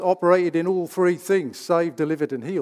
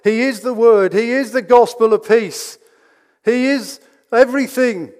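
loud, rising declarations, each "is" hit hard; full-force preaching; 5 semitones higher, 6 dB louder than usual. Acted. Preacher proclaiming to the congregation that Jesus is the armor, loud and rising. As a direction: build it like a climax, proclaiming.

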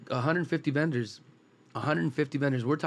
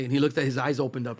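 usual speaking voice

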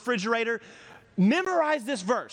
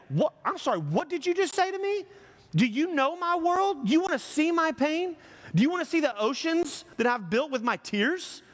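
high, mock-offended outburst, hammed-up; indignant overreaction; 7 semitones higher than usual. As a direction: play the wounded drama queen, pitch jumped up. Indignant reply to "just relax.

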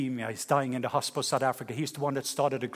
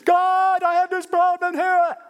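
high, loud cry to God; desperate Moses; 14 semitones higher, 7 dB louder, slower than usual. Acted. Moses crying out to God about the problem, high and loud. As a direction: call up for help, at the top. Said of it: "in the middle".

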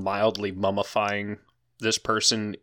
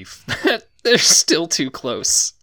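laughing; 7 semitones higher, 6 dB louder, much slower than usual. Real, not acted. DM laughing while counting.

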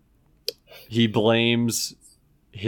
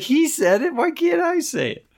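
put-on high, pleading child voice; mock indignant child asking why; whiny kid protest. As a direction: protest like an innocent little kid.

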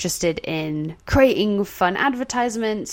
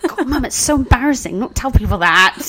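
higher-pitched mock embarrassed whine; embarrassed child; 5 semitones higher than usual. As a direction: imitate a mortified kid pleading with mum, half laughing. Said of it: "I was so embarrassed by it".

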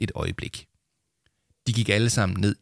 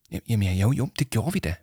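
plain reading voice

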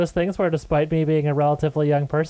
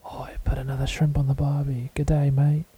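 put-on whispered Australian accent; a breathy Australian mutter, barely voiced. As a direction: mutter it like an Aussie under his breath. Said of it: "so he's muttering like".

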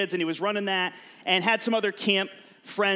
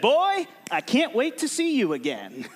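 put-on kid's voice; 4 semitones higher than usual. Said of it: "he said".

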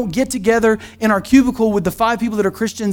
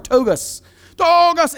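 mock shout of strange word; 7 semitones higher, slower than usual. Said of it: "he starts shouting, like, shouting this, like, strange word".

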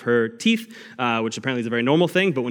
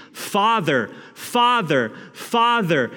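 mock crying out; 7 semitones higher, much slower than usual. Preacher voices believers crying out to God, a mild mock cry. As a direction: cry upward like a desperate child. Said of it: "and we're screaming".

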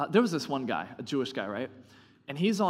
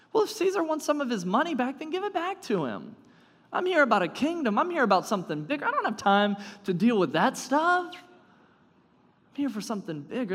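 impatient brush-off; 10 semitones higher, 5 dB louder than usual. Retold or imitated. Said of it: "it's like he's saying".

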